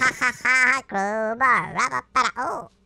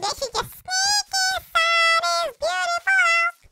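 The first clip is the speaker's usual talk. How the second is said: sneaky put-on voice. Sneaking into dog's house.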